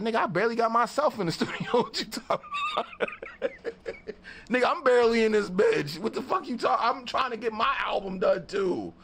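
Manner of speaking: high, fast, laughing disbelief; laughing retort. Retold or imitated. Narrator laughing off the cocky guy's boast. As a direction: crack up while shutting him down.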